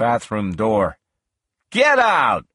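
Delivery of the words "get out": high-pitched angry command; angry shout. A narrator's reading. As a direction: order them out with a raised voice. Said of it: "then I got angry".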